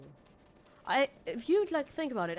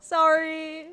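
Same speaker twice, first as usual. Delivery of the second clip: loud sorry; 8 semitones higher, 14 dB louder, much slower than usual. Real, not acted. Apologizing loudly after a fall.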